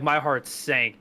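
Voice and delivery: usual speaking voice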